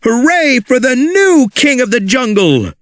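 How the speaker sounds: high cheerful hooray; cheering. Acted. Mister Pricklepants hailing Rex, high cheerful hooray. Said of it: "said Mister Pricklepants".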